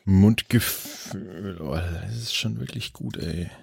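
words pushed out through a burp. Real, not acted. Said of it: "Warum rülpst der heute den ganzen Tag?".

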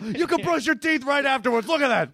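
hammed-up TV infomercial host; infomercial pitchman. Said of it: "series of infomercials".

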